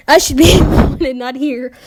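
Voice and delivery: rereading it with a loud laugh. Real, not acted. Narrator cracks up rereading his own line. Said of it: "Wait, what?".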